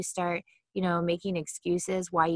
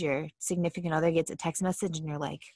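ordinary talk

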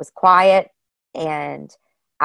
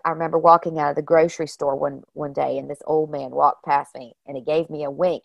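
ordinary talk, nothing marked

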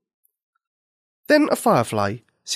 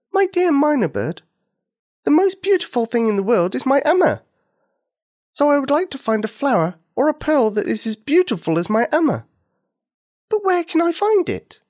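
put-on high, tender little girl, wondering; a child describing her beautiful mother; 13 semitones higher, 3 dB louder than usual. Nikini answers the bird.

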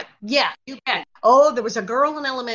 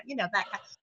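ordinary talk

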